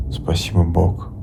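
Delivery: usual speaking voice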